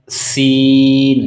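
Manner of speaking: سین with a long hissing, whistle-like s, very slow; سین سیٹی جیسی آواز کے ساتھ; whistling s. Shown as a demonstration. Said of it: "یہ سین جو ہے سیٹی کی آواز نکالے گا".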